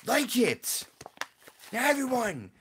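high-pitched loud scream at viewers; high, yelled demand. Real, not acted. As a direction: scream it out to hype the crowd. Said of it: "I screamed at them".

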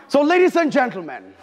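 building to a loud, high hype call; hype announcer. Acted. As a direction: announce her like a stage MC. Host announces next contestant.

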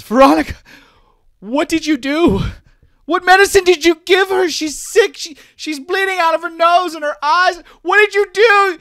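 a terrified mother shrieking into the comm; panicked mother. Acted. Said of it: "Dash's mother is clearly panicked".

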